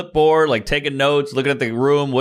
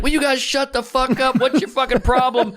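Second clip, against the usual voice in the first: retold snapping; 10 semitones higher than usual. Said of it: "And eventually I just snapped".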